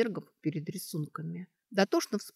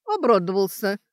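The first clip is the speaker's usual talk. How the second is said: loud, higher mocking retort; 4 semitones higher, 9 dB louder than usual. A narrator's reading. Sashka taunts the orc over his demand, mockingly. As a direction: throw it out as a loud jeer. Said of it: "насмешливо сказала Сашка".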